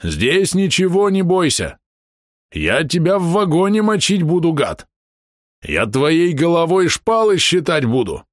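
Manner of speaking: high, slow furious threats; menacing threat. A narrator's reading. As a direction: roar the threats. Bom threatens the skeleton.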